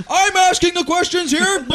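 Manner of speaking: very high, loud, drawn-out cop impression; exaggerated bossy officer shout. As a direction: yell it slowly to take over the argument. Cop retold, snapping back.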